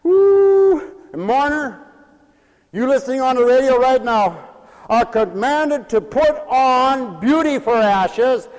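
slow, high, loud commands; full-force preaching. Real, not acted. Preacher forbidding the congregation to complain, full-force preaching. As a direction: ring out each command, drawing out the key words.

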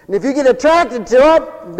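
loud, high, fervent preaching; preaching at full force. Acted. Preacher rousing the congregation, loud fervent preaching.

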